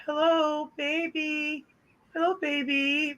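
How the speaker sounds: high, sweet imitation of a pet bird; cute bird voice. Describing mom's bird to a friend, high sweet bird voice. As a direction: coo it like a parrot luring you in. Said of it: "She'll lure you in with her cute".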